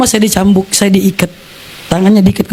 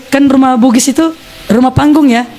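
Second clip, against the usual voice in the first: ordinary talk, nothing marked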